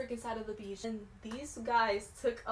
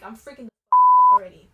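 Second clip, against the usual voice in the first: panicked shout; 22 dB louder than usual. Retold or imitated. Retelling inner panic.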